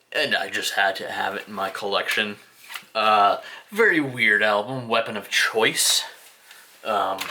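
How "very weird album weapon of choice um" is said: ordinary talk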